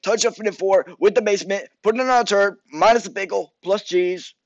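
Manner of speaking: high, loud goofy caller voice; short user voice. Pretend. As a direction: rattle off the order in a high put-on voice. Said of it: "Do the short user voice".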